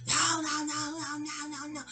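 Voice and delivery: high, wailing string of protests; mock yelling. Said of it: "just yelling and yelling and yelling".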